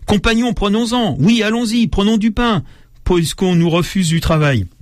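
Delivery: lightly acted rousing cry to comrades; rallying young revolutionary. A young revolutionary urges the crowd to take bread, rousing. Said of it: "jeunes révolutionnaires de Montmartre".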